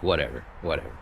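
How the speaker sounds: lightly voiced resignation; resigned. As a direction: give up under your breath. Giving up on the call.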